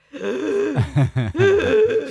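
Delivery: high, loud burst of laughter. Real, not acted.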